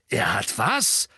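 gedehnt, fassungslos nachgefragt; ungläubig herausrufen, am Ende steil steigend; entsetzter Ausruf. A narrator's reading. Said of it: "rief Sirius". (entsetzt ausgerufen)

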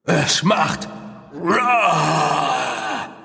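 put-on child roaring like a monster. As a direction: roar it out, playful beast. Child imitates monster roar.

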